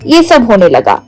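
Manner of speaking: plain reading voice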